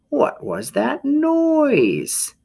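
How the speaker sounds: very high slow puzzled voice; sleepy possum. Acted. Possum woken by the noise, very high and slow. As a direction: wonder aloud, drowsy.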